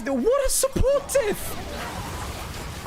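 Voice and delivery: very slow, high drawn-out jeer; mocking. Real, not acted. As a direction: drag it out to mock. Mocking the support player.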